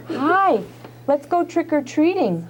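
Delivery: slow, lifted invite to a little brother; eager kid voice. Shown as a demonstration. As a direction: show them how the kid would say it. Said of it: "Watch this".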